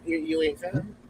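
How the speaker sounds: ordinary talk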